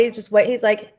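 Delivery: normal talking voice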